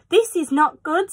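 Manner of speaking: high, anxious fish voice protesting; a worried little fish crying no; fretful fish. Acted. Fish in the pot warning the children, high and anxious. Fish frets after cat leaves.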